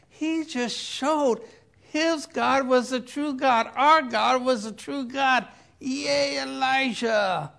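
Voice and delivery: loud, high, excited cheer; cheering crowd. Acted. Crowd cheers Elijah for the true God, excited. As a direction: celebrate a champion's win.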